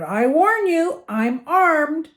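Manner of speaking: high, loud, drawn-out threat; shouted warning. A narrator's reading. Armed uncle warns whoever is at the door, shouting. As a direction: warn the intruder off at a shout. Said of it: "he shouted".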